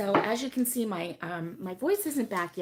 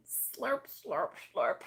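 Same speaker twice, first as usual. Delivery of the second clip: drawn-out mock slurps, dropping low; relishing, onomatopoeic slurp words; playful slurping; 4 semitones lower, 4 dB quieter, much slower than usual. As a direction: say it like you're slurping hot coffee. Playfully acting out slurping coffee.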